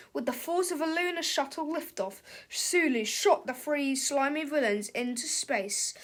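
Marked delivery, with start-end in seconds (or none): none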